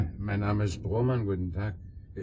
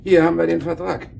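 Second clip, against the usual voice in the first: emphatic presenting; 8 semitones higher, 12 dB louder than usual. Acted. Manager produces contract as proof.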